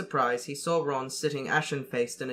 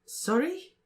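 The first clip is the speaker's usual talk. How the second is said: put-on high, puzzled girl's voice; Cho puzzled; 13 semitones higher than usual. A narrator's reading. Cho not catching Harry's blurted question, high and puzzled. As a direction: ask it high and surprised. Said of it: "said Cho".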